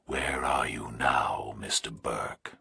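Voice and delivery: low, slow, unvoiced and calm; calm nightmare voice. A narrator's reading. Kidnapper calmly asking Burke where he is, eerie whisper. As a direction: ask it like a patient predator. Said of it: "went ahead calmly and asked".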